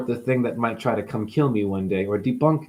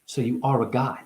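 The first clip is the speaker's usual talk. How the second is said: ordinary delivery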